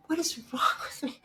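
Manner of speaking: whispered, half-laughing despair; high laughing whispered question. Retold or imitated. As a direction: breathe it out with a helpless laugh.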